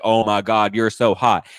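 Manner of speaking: loud mock-excited outburst; imitated gushing. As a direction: imitate an overexcited fiancée at full volume. Imitates woman running at fiancé.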